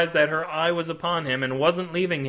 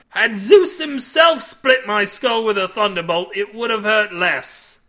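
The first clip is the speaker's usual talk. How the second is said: loud, raised, slow grandiose protest; impassioned cry; 5 semitones higher, 6 dB louder than usual. A narrator's reading. Milby insisting to Cade on his agony, crying out. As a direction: make the pain sound mythic.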